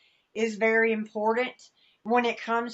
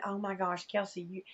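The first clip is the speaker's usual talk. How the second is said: much softer, a half-swallowed gasp of worry; hushed alarm; 9 dB quieter than usual. Retold or imitated. Mother in the baptism water sees her daughter wading in, hushed alarm. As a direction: drop to a near hush, anxious. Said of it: "and I'm going".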